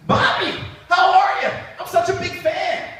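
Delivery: excited fan voice; starstruck fan. Retold or imitated. Chef gushing at celebrity.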